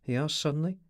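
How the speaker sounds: plain reading voice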